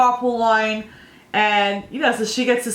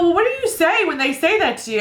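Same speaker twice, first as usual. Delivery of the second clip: higher, eager mock-curious question; amused curiosity; 8 semitones higher than usual. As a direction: ask the little one eagerly, half laughing. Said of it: "I go".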